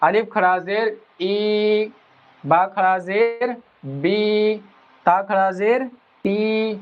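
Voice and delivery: very slow teaching recitation; slow drawn-out drill. Shown as a demonstration. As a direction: hold the long ای, بی, ٹی slowly. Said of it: "بڑی کی آواز نکال دیں گے".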